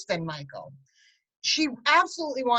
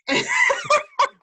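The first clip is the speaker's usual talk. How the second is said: high, loud laugh; burst of laughter; 5 dB louder than usual. Real, not acted. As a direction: laugh out at your own remark. Laughs after living hell remark.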